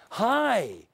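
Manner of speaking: high, drawn-out HI; bright greeting. Shown as a demonstration. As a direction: stretch HI out on a high, falling tune. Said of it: "how the word sounds".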